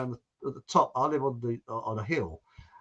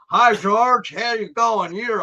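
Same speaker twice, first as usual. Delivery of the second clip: loud, higher mock American greeting; terrible American accent; 4 semitones higher, 5 dB louder, slower than usual. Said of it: "adopt terrible American accent".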